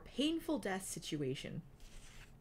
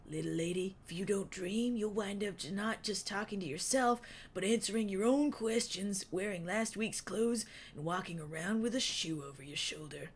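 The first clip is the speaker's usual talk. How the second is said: Wingtip's voice; 2 semitones higher than usual. A narrator's reading. Wingtip's held voice.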